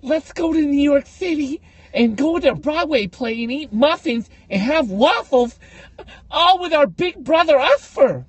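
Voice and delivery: high, slow, gushing mock of the sisters; sing-song mocking of excited sisters. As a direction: squeal it like giddy sisters planning a trip. Mocking sisters' trip plan.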